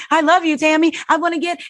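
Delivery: high-pitched mock tearful gushing; imitated tearful outburst. Beth pouring out her feelings to Tammy, imitated tearful. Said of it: "and she's crying".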